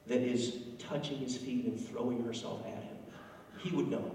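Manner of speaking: hushed, sneering gossip under the breath; sneering aside. Retold or imitated. Simon sneering to himself about the woman, hushed. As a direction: whisper it like a snide aside. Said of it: "I imagine Simon doing this".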